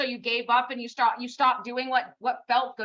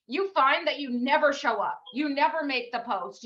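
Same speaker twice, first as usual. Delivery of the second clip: normal talking voice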